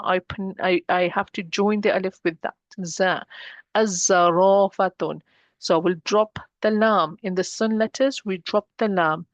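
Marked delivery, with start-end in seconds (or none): 3.7-5.2 slow Az-zarafatun, doubled Z, long "raa"; lam dropped. Shown as a demonstration. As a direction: skip the L and hold the Z in Az-zarafatun. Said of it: "I'm dropping the lam".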